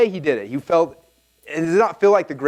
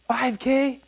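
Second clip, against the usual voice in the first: high-pitched disbelieving question; acted-out astonishment, pitched way up; incredulous; 11 semitones higher than usual. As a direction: balk at the figure, voice jumping up. Reacts in disbelief to figure.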